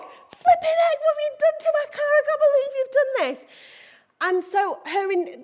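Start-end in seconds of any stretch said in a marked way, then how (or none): 0.0-3.5 very high, loud, fast squawk of outrage; acted outrage. Driver confronting whoever bumped the car, high loud outraged rant. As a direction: let indignation burst out in a high, rapid rant. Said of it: "I would be, I'd be like".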